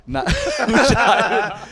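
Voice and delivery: high, slow, laughing through it. Real, not acted. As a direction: laugh while correcting. Laughing at the mangled name.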